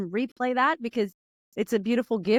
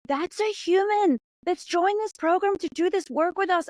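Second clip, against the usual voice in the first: tearful awe; 9 semitones higher than usual. Retold or imitated. Moved while watching program videos.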